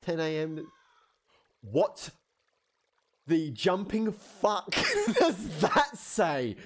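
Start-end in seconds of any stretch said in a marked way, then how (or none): 1.6-6.6 baffled. Real, not acted. Baffled outburst at game.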